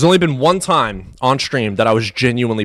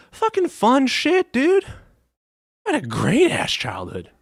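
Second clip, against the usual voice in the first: giddy gush; 11 semitones higher, 3 dB quieter than usual. Real, not acted. Streamer recalling bonfire nights with friends, giddy and high. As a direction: squeal it, lost in a happy memory. Gushing over childhood bonfires.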